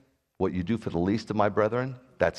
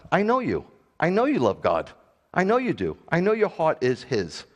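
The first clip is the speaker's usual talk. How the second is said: insistent reassurance; 7 semitones higher, 3 dB louder than usual. Real, not acted.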